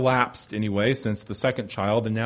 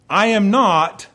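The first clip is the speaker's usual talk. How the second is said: raised, forceful declaration; emphatic declaration; 6 semitones higher, 7 dB louder, slower than usual. Acted. God disowning his people in Hebrew, emphatic declaration. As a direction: declare it louder and higher. God's rejection voiced by the preacher.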